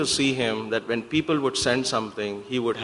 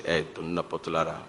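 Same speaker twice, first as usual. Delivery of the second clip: Swami's voice; 7 semitones lower, 7 dB quieter than usual. Retold or imitated. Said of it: "So he would just say".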